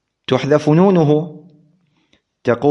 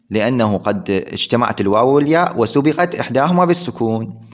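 normal talking voice

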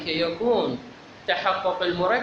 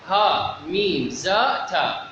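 slow, separated letter names; spelling letter names; slower than usual. Shown as a demonstration. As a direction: count off each letter by its name, one by one. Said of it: "لأن إذا تهجيتها صارت أجزاء".

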